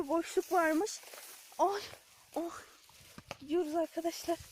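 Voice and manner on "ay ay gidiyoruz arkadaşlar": ordinary talk, nothing marked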